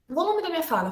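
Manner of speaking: normal talking voice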